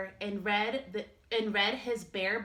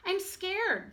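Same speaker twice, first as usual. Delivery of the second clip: higher small cub voice; a tiny scared cub, pitched up; little bear cub; 9 semitones higher than usual. Acted. Little Bear tells Big Bear he is scared, high small voice.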